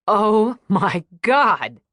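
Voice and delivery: loud, very slow and drawn out; savouring amazement. Acted. Nina tasting the walnut pastry, blissfully amazed. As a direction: stretch each word in bliss at the taste. First bite of delicious pastry.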